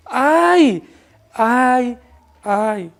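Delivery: drawn-out wail, falling and fading; acted lament. Preacher voices weeping farmers under God's judgment, lamenting. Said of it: "Campesinos llorando, reyes llorando".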